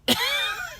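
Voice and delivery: a brief, high burst of laughter; high cackle. Real, not acted. Hearing she kept the money, a high cackle. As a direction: cackle at the twist.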